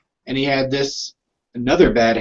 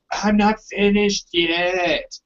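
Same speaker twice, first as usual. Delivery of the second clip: put-on high, slow child's protest; whiny kid voice; 6 semitones higher, much slower than usual. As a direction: drag it out like a stubborn kid.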